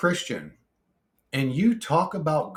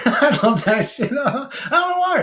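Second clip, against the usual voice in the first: high, loud laughing delight; 12 semitones higher, 7 dB louder than usual. Real, not acted. Amused by accented cursing, real high laughing voice. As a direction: talk right through the laugh, pitched way up.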